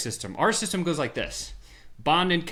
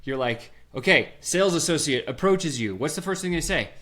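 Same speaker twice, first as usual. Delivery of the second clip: ordinary talk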